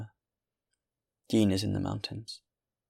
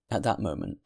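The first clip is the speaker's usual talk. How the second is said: usual speaking voice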